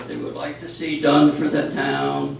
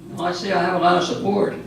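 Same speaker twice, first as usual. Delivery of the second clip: quick, higher, louder dry jab; sarcastic; 5 semitones higher, 4 dB louder, faster than usual. Real, not acted. Commissioner mocks the silence after asking for responses, fast sarcastic quip. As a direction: needle the silent room with a fast ironic quip. No one responds to his request.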